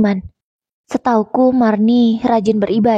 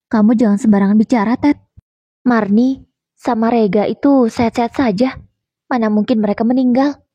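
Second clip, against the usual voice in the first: usual speaking voice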